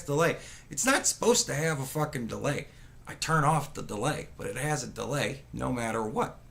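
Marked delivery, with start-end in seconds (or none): none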